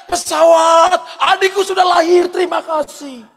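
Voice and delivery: put-on childlike thankful holler; yelling at a plane. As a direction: call out to a passing plane.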